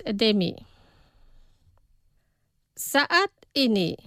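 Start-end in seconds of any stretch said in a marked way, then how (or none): 2.8-3.9 slow, careful model pronunciation; pronunciación despacio. Shown as a demonstration. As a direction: say it slowly for learners to repeat. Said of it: "Los pronunciaré despacio". (pronunciado despacio, para repetir)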